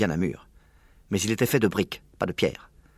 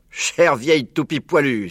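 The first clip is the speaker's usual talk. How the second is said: slow, louder fond exclamation; exclaimed; 5 semitones higher, 6 dB louder, slower than usual. A narrator's reading. Mr Fox exclaiming to Badger, slow and loud. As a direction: cry it out slowly, fond and exasperated. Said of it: "s'écria-t-il".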